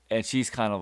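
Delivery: ordinary delivery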